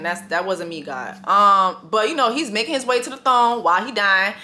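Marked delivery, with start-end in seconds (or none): 0.0-1.2 hushed, much softer apology to God; a guilty half-whispered aside; sheepish apology. Real, not acted. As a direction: murmur it quickly so God hears.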